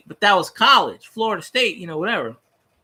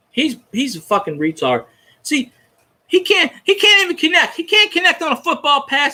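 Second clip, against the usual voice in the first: ordinary delivery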